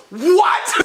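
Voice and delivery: laughing shout of surprise; mock surprised what. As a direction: blurt it out in disbelief. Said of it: "I was like".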